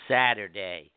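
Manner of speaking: put-on child's yell. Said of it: "And Sam yells".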